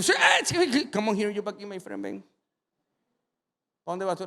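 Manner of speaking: high, loud at first, pushy wheedling; a touch of a pushy hustler; pushy Andrés. Retold or imitated. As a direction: coax the boy over slyly. Andrés grabbing the boy's food.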